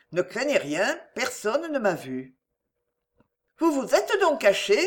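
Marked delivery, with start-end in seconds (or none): 3.6-4.8 a high, sudden, agitated question; startled and unsettled, she blurts it out; agitated cry. A narrator's reading. Clémence, alarmed that he hid in her house, cries out agitated. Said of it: "s'écria Clémence avec agitation".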